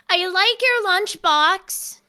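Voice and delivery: high, loud, drawn-out eagerness; eager child character. Acted. Totoi pointing at the lunchbox, eager childlike voice. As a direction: blurt it slowly and high, full of admiration.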